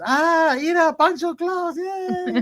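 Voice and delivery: put-on very high, thrilled child voice; high excited kid cheer. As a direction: squeal like kids seeing Santa. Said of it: "And they're just".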